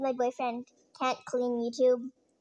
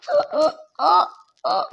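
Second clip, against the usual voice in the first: louder and higher, rising into a shrieking yes; ecstatic yes; 7 semitones higher, 11 dB louder than usual. Pretend. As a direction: squeal it out, overjoyed at the proposal. Accepting surprise marriage proposal.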